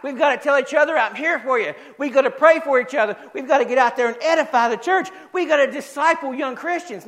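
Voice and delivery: high, driving pulpit exhortation; rally the congregation with pounding repeated phrases; fired-up preacher at full pitch; full-force preaching. Real, not acted.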